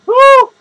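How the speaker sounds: sudden high, loud "woo"; real loud whoop. Real, not acted. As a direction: let out a big, high whoop. Lets out a spontaneous whoop.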